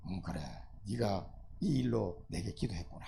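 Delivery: low, soft, fond divine voice; gentle low approval; pleased God. Acted. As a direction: murmur it warmly like a delighted father.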